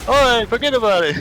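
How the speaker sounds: hammed-up wiseguy; cartoon tough guy. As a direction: wave it off like a cartoon mobster. Jumbled cartoon voices bit.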